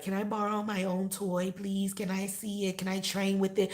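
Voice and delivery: high, mock-pleading child voice; begging kid. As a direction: beg like a kid asking for a toy. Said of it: "like you a fucking kid at the store".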